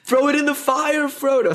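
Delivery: lightly voiced Sam urging Frodo on; quoting Sam. Quoting Sam urging Frodo to destroy the ring, lightly voiced high plea. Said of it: "I love quoting Sam".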